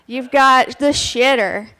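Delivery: ordinary delivery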